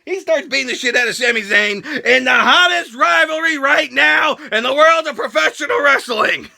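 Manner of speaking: ordinary talk, nothing marked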